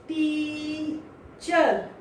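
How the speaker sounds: टीचर stretched slowly on the er; slow er ending. Shown as a demonstration. Teacher drawing out the er sound. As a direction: drag out the er of टीचर. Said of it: "अर की टीचर".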